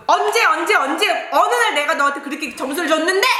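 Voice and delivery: high, rapid, hammering mock interrogation; irate teacher. Teacher challenging pupil about the score, high imitated scolding. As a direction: fire off the questions like a scolding teacher. Teacher demands when she graded so.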